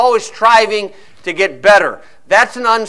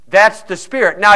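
ordinary talk

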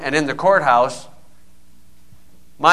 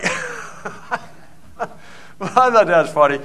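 laughing; 3 semitones higher, much slower than usual. Real, not acted. Preacher laughs at story.

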